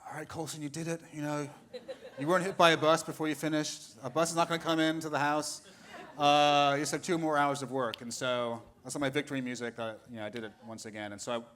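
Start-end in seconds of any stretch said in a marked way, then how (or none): 0.1-8.0 self pep talk. Retold or imitated. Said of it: "I'm like".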